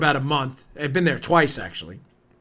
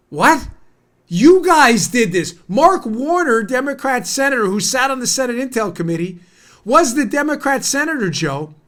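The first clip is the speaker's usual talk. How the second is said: raised pitch, forceful accusation; indignant rant; 6 semitones higher, 3 dB louder than usual. Real, not acted. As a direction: build an outraged case against a senator. Indignant rant at Mark Warner.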